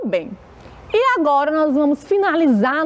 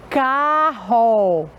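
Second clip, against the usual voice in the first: "carro" drawn out as ca... rro; syllable-by-syllable reading. Shown as a demonstration. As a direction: read "carro" slowly, both syllables held. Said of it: "Vamos ler com a tia".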